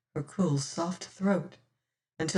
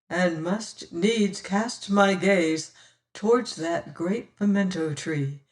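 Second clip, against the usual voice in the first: plain reading voice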